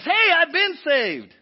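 loud, slow, relieved celebration; joyful praise. Shown as a demonstration. Preacher demos grateful praise, loud and slow. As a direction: sing out that you're saved. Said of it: "The other one was praise".

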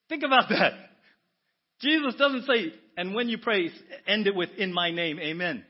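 loud, high emphatic declaration; preacher hammering the point home; emphatic preaching. Real, not acted. Preacher to congregation, loud and emphatic. Preacher building to full force.

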